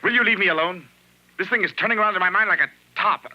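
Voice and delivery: cornered husband blowing up; angry outburst. Acted. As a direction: push her away with your voice. Husband snapping back in quarrel.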